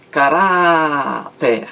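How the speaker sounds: "caráter" com o RÁ bem esticado, caráááter; ênfase na sílaba RÁ. Shown as a demonstration. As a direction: alongue muito o RÁ de "caráter". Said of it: "A sílaba mais forte é ra". ("caráter" lento, segurando a penúltima sílaba)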